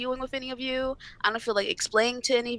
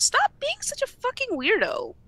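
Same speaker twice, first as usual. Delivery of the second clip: 1 exasperated scolding; 11 semitones higher than usual. Real, not acted. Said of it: "scolding harassers".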